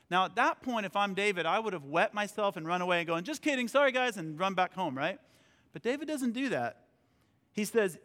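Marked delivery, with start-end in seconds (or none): 3.2-4.2 quick, higher-pitched pretend apology; mock backing out. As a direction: hastily take it back in jest, pitched up. Acts himself backing out before Goliath.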